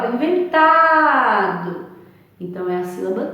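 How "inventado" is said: "inventado" with TA stretched high; teacher's exaggerated long TA in "inventado"; stress on TA. Shown as a demonstration. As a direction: dwell loudly on the TA in "inventado". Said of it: "fala um pouquinho mais".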